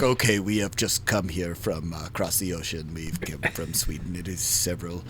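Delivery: a mildly imitated Swedish singer, quiet and let down; Swedish accent. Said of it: "the guy's like in his accent is like".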